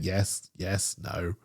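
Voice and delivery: quiet, stilted mock Russian agent; retelling the Russian agent's stiff answers, quiet mock voice; stilted Russian yes-and-no. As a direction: mimic his stiff yeses and nos, quietly. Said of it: "he's just saying".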